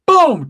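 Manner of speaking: loud, high shouted boom. Retold or imitated. Body slam on the curb, shouted boom. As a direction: slam it out like a wrestling hit. Choke slam sound effect.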